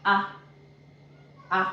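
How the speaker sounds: "A" as a short open "ah"; letter-sound demo. Shown as a demonstration. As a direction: sound out "A" as a crisp, isolated "ah". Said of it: "A is pronounced as".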